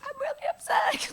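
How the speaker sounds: squeaky high voice, too upset to talk. Retold or imitated. Telling the director she's too upset, voice squeaking. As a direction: pitch up into a tight, choked squeak. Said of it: "I'm like".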